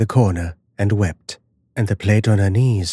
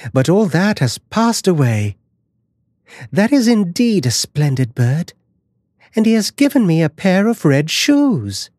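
put-on happy girl; 8 semitones higher than usual. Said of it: "felt quite happy and light-hearted".

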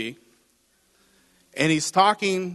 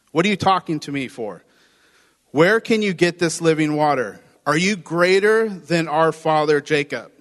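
sarcastic put-down; 3 dB louder than usual. Retold or imitated.

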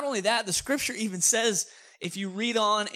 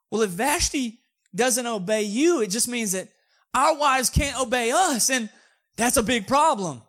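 loud, raised-pitch mock alarm; alarmed nobles; 6 semitones higher, 6 dB louder than usual. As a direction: warn the king loudly, hammed-up. Nobles voiced warning the king.

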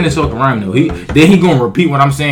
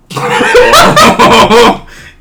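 very high, loud whoop of laughter; loud falsetto laughing whoop; 10 dB louder than usual. Real, not acted. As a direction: crack up in a piercing howl. Jeering a failed rhyme.

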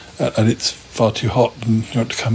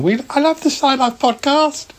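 much higher voice for the fan; squeaky high little fan voice; fan's voice. Retold or imitated. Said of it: "this guy who stands in the corner going".

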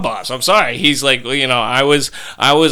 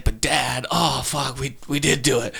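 put-on softer, like a guilty kid's aside; imitated sheepish confession; 6 dB quieter than usual. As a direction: act out owning up under your breath.

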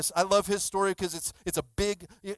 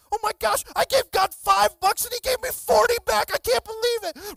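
very high, loud excited squeal; thrilled disbelief; 8 dB louder than usual. Retold or imitated. Girl tells pastor of forty back, ecstatic. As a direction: imitate a girl bursting with amazed excitement.

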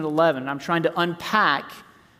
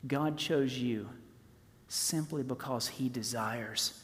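slow and softer, tender; 5 semitones lower, 10 dB quieter, slower than usual. Real, not acted. Preacher tenderly assuring believers they are desired. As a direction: let it sink in gently.

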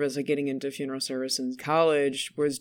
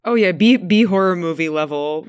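loud, laughing delight; bursting in with glee; amused exclaim; 9 dB louder than usual. Real, not acted. Cohost reacting to the creepy story, loud and amused. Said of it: "the creepiest".

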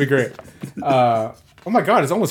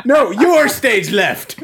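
exasperated; 7 semitones higher, 4 dB louder, much slower than usual. Real, not acted. Exasperated directing friend.

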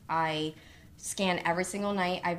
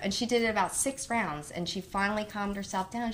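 ordinary talk, nothing marked

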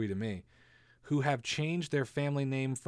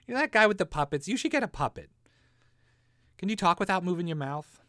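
high, loud nagging mom; 7 semitones higher, 6 dB louder than usual. Retold or imitated. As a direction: nag like a mom about puppets. Mom voiced telling him about puppets.

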